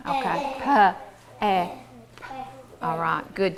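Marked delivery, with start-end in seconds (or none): 0.6-1.7 "P, eh" as two separate sounds, puh and eh; sounded out. Shown as a demonstration. Teacher leading the vowel drill, "P, eh" said sound by sound. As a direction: pause between "P" and "eh". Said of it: "the vowel sound".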